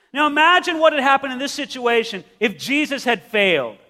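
ordinary delivery

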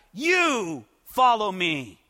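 slow, with weight on you; emphatic YOU. Acted. Jesus directing Peter, emphatic on you. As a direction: lean hard on you, slowly. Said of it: "the phrasing in the original is very emphatic".

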